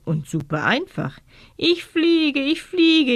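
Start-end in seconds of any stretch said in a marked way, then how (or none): 1.6-3.2 much higher, exultant cry of flying; a child cheering as she flies; jubilant. A narrator's reading. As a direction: shout it out with pure joy at flying. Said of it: "jubelt Katrin".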